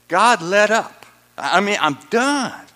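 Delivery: loud, worn-out plea; despairing cry. Acted. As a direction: shout it like you've had enough. Voicing Paul's despair.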